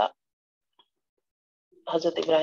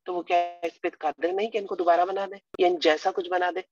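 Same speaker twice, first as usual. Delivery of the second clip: ordinary talk, nothing marked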